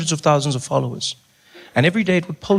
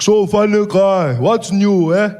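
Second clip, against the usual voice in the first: slow, louder, drawn-out voice; Malema impression; 5 semitones higher, 4 dB louder, slower than usual. Malema chatting with the comic, slow louder voice. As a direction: lean back and drawl it. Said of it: "He's like".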